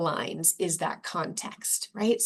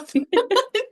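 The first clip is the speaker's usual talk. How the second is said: laugh; 8 dB louder than usual. Real, not acted. Laughs while praising co-host.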